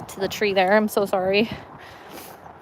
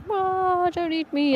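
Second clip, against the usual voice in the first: much higher, squeaky voice of a scared little minnow; panicky minnow voice; 9 semitones higher, slower than usual. Pretend. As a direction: squeal it like a tiny fish about to be eaten. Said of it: "one, like, flutters around and goes like".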